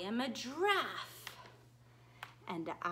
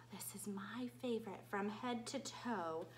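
whisper; 5 semitones lower, 9 dB quieter than usual. Acted. Teacher whispers book title.

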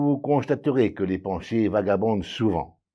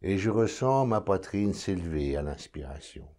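calm, measured meditation voice, lower and softer; guided meditation; 3 semitones lower, 4 dB quieter than usual. Acted. Meditation guide leading listeners to notice their breathing, calm and unhurried. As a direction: guide the listener inward, with long silences between phrases.

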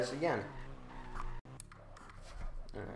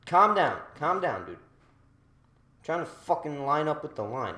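annoyed; 3 semitones higher, 7 dB louder than usual. Real, not acted. Annoyed at other racer.

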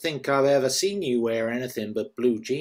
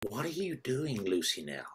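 hushed question; 10 dB quieter than usual. Acted. Delphine's hushed question at checkout.

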